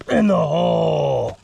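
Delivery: booming mock-dramatic shout; loud, slow, drawn-out storyteller call; deepest story voice. Mom performing the family's made-up story, booming voice. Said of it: "in the deepest voice they know how".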